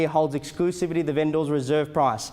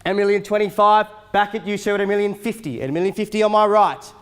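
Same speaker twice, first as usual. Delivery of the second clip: loud, rapid auctioneer calling; auctioneer calling bids; 5 semitones higher, 5 dB louder than usual. Acted. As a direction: rattle off the bids to the crowd. Live auction bidding calls.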